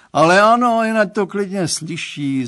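high, loud cry from the father; exclaiming. A narrator's reading. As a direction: call it out big, overriding an objection. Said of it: "zvolal pan Stránský".

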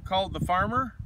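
plain reading voice

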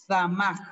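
"zamaj" opening on a strong hissed ts; model pronunciation. Shown as a demonstration. Teacher showing how nose is said, "zamaj" with ts. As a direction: start "zamaj" with a sharp "ts". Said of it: "Se pronuncia".

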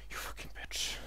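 very soft, voiceless insult; whispered curse. Real, not acted. Gamer cursing the enemy who hit them, whispered. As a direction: swear under your breath.

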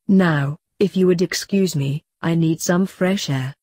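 ordinary delivery

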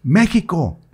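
calling out to the passing Pope; retold shout. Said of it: "le grito".

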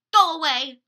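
loud shout to the pigeons. Acted. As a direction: yell it to shoo birds away. Shooing the pigeons off.

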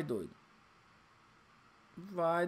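usual speaking voice

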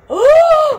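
loud, high, sharp gasp; shocked gasp. Real, not acted. Vlogger making the first cut, shocked gasp.

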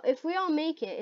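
normal talking voice

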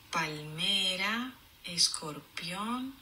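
very slow. Shown as a demonstration. Labels read very slowly.

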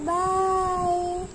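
long, high, held-out goodbye; sung-out parting call. Acted. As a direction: stretch the farewell out high and level. Girl leaves, calls goodbye.

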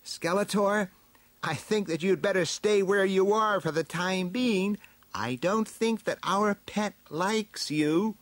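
high-pitched heroine calling into a tree; high, carrying storybook heroine. A narrator's reading. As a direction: call up to someone in a high character voice. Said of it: "Teela called up into the tree".

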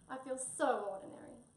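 high, self-pitying sigh. A narrator's reading. Tabby feels outshone by a toy, sighing. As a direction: sigh it out, feeling sorry for yourself. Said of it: "he sighed".

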